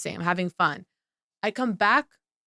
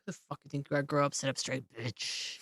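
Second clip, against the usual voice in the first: muttered scolding; 7 semitones lower, 13 dB quieter than usual. Real, not acted. Scolding the diner, fading.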